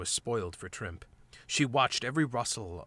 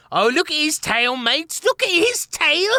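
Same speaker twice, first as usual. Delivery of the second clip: high squeaky mocking voice; jeering rat; 9 dB louder than usual. A narrator's reading. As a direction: taunt them in a high squeak.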